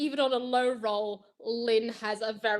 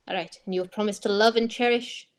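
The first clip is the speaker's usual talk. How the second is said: ordinary delivery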